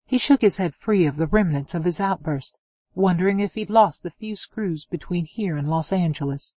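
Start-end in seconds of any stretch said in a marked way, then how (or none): none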